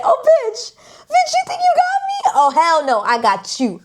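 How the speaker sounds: very high, squealing mock comeback; sassy defiance. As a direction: start in a squeal, come down to a cocky finish. Voices character's defiant comeback.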